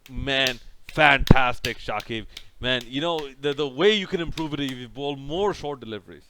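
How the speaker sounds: mock praise, dripping sarcasm; sarcastic fake compliment. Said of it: "I started to get sarcastic".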